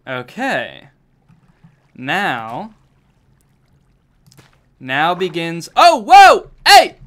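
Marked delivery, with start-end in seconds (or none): 5.8-7.0 sudden high, loud yelp of surprise; jolted shout as the water hits; startled yelp. Real, not acted. Streamer caught off guard by rushing water, yelping high and loud.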